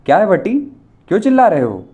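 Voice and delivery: loud, high call from the bathroom; shouting from inside. A narrator's reading. Girl shouts from the bathroom, loud. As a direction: call out loudly from another room. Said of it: "किसी ने चिल्लाकर कहा".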